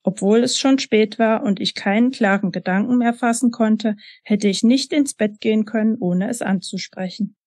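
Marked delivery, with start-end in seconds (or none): none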